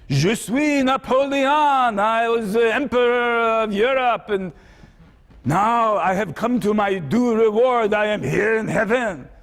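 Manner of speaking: a hint of proud Napoleon announcing himself. Napoleon waking in heaven, declaring who he is, lightly voiced proud emperor.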